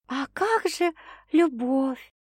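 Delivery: put-on high, slow starry-eyed girl's voice; dreamy, inspired. A narrator's reading. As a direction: sigh about love dreamily. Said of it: "одухотворённо напомнила Саша".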